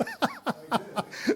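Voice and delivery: high-pitched burst of laughter; real laugh. Real, not acted. Host cracking up over retiring to Fall River, high laughter.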